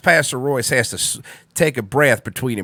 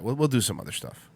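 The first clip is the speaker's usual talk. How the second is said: low, quiet, half to himself; subdued aside; 3 semitones lower, 8 dB quieter than usual. Real, not acted. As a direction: say it softly and low. Muttered first take, then repeated up.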